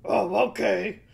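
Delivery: loud, grudging troll voice. A narrator's reading. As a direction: give in loudly as the troll. Troll gives in to goat.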